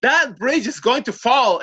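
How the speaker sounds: high, slow, emphatic mock alarm; alarmed warning. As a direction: act out an insistent warning of danger. Acts out her alarmed warning. (advertencia alarmada imitada)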